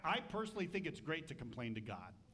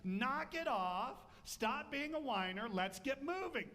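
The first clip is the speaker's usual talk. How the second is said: stern scolding; 8 semitones higher than usual. Retold or imitated. God telling the whiner to move on, stern. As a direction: snap him out of the sulk. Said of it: "And God's like".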